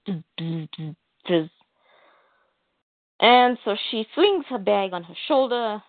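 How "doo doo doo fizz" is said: imitated low electronic blips ending in a fizz; computer breaking down. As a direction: make the noise of a short-circuiting computer. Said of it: "as the computer's going".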